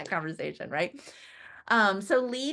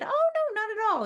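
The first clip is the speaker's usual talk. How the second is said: eager reassurance; 14 semitones higher, 4 dB louder than usual. Retold or imitated. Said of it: "And I said".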